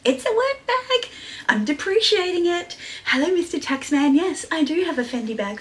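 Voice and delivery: playful high-pitched quip; playful high joke. Real, not acted. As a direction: tease the taxman. Joking about tax.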